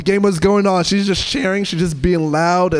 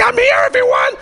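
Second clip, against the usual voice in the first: high, loud screechy Marge Simpson voice; loud screech; 4 dB louder than usual. Retold or imitated. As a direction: screech it like a cartoon mom. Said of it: "a loud, like, screech, like, Marge Simpson's voice".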